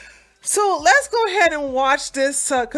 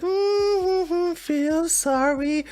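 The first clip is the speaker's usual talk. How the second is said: slow whiny mock of Alec begging sympathy; mock pity plea; 4 semitones higher, much slower than usual. Mocking Alec to viewers, slow whiny pity plea. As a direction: drag it out like a fake sob story.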